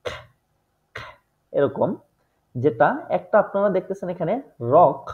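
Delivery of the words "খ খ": খ as a throaty k-h; খ, guttural German ck sound. Shown as a demonstration. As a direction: rasp out খ from the back of the throat. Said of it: "সেকে সাধারণত উচ্চারণ দিয়ে থাকে".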